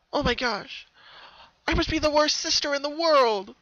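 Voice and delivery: high, loud self-reproach; feeling rotten. Acted. Pinkie ashamed at forgetting her sister, loud and high. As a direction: cry out in guilt at herself. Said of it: "feeling so rotten".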